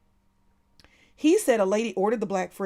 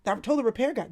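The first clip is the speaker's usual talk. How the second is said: ordinary talk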